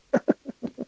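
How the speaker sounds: high-pitched giggle; high laugh. Real, not acted. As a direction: burst into a squeaky laugh. Laughing at the joke.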